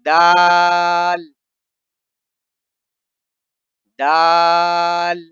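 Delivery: دال and ذال stretched very slowly; ذال with the tongue out, stretched long; slow drawn-out letter names. Shown as a demonstration. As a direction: draw each letter out, very slowly. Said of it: "ایک دفعہ پھر سے پڑھتے ہیں".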